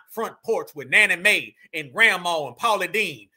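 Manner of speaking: low parody of a Southern supremacist; mock Southern war call. Reactor showing viewers what they say, mock Southern war call. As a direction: intone it as a lofty war cry, pitched well down. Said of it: "This is a war call".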